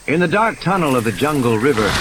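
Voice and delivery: ordinary delivery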